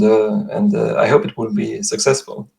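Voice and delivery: ordinary talk, nothing marked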